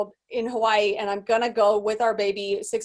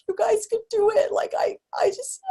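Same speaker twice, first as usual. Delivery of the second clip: retold crying; 12 semitones higher than usual. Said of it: "crying and being like".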